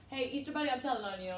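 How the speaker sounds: high, loud pretend tattling call; faked phone call. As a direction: tattle into a phone in mock alarm. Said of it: "I'm, like, faking it".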